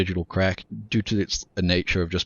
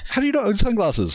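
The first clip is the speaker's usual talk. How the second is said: much higher, laughing disbelief; incredulous. Real, not acted. Teasing a co-host who owns no sunglasses, incredulous and high. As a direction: pitch it up in mock outrage. Repeats question, squeaky disbelief.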